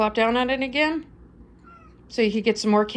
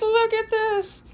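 delighted slow coo; slower than usual. Real, not acted. Showing off the excited cat.

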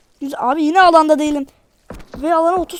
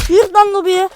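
taunting shout; 5 semitones higher, 8 dB louder than usual. Real, not acted. Taunting killed enemy in game.

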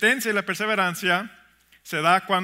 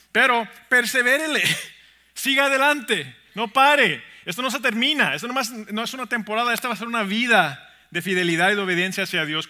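raised, higher preacher's voice; rousing exhortation; 4 semitones higher, 6 dB louder than usual. Real, not acted. Preacher spurring the congregation to keep going, rousing exhortation. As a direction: urge them on loudly.

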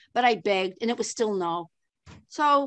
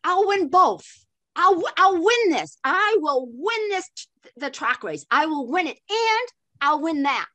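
eager pleading, pitched higher; retold begging; 5 semitones higher, 3 dB louder than usual. Patty begging officials to let her run, eager plea. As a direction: beg to be let onto the track. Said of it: "I begged".

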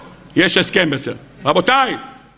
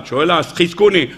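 ordinary talk, nothing marked

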